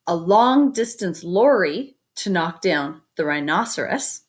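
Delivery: plain reading voice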